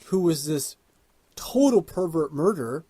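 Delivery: ordinary delivery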